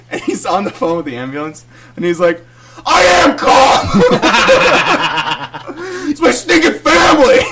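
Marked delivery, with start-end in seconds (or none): 2.8-7.4 high, slow, full-volume bellow; imitated dad yelling. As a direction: yell it like a frantic dad, cracking up. Dad yelling on phone, acted out.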